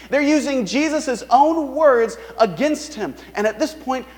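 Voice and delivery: normal talking voice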